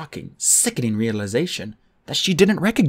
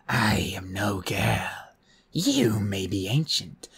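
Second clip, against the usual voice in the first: harsh croak. Acted. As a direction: croak with ancient menace. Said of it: "her voice was a harsh croak".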